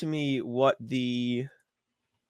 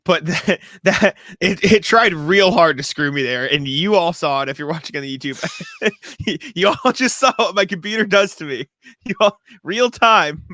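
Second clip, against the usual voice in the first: louder, laughing in disbelief; 5 semitones higher, 8 dB louder than usual. Real, not acted. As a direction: giggle through the complaint. Laughing about computer glitch.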